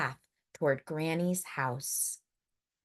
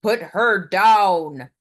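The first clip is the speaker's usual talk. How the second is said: slow, much louder command; woodcutter's bellow; 9 dB louder, slower than usual. Acted. The woodcutter ordering the wolf, a slow loud bellow. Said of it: "bellowed the woodcutter".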